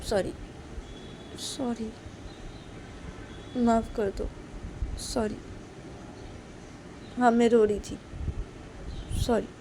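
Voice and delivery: acted contrite pleading; pleading apology. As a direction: beg forgiveness in small separate bursts. Tearfully apologising to angry friend.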